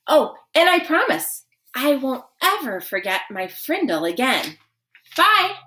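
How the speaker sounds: high, cheery mock-innocent boy; cheeky parting line. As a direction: toss it off sweetly cheeky. Nick's parting jab.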